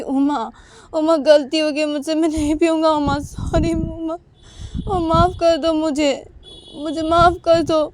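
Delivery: husband pleading at the locked door; pleading apology. Retold or imitated. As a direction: beg her through the door. Knocking, begging wife's forgiveness.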